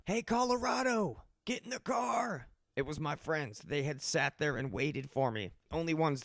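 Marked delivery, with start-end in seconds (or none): 0.1-2.5 calling out high across the lot; mock yell. Friends in the van calling him over, mock yell. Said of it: "and they yell".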